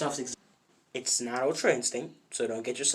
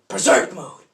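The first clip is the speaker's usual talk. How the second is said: loud, high battle cry; sudden acted shout; 5 semitones higher, 11 dB louder than usual. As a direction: call out the power-up like an anime hero. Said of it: "says out loud".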